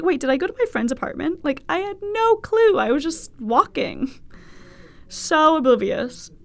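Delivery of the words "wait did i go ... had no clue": confused. Retold or imitated. Retold confusion, high pitch.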